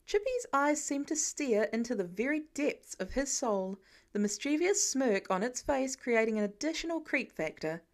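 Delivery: ordinary delivery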